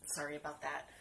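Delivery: normal talking voice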